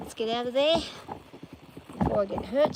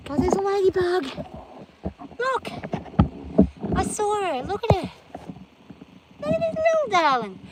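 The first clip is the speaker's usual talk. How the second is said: cooing at a ladybug; 12 semitones higher, 7 dB louder than usual. Real, not acted. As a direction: coo over it like a tiny pet. Cooing over a ladybug on rock.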